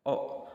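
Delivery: "O" voiced from the base of the throat; vowel o demo. Shown as a demonstration. Teacher showing students the throat vowel. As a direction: say "O" deep in the throat. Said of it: "O seperti itu".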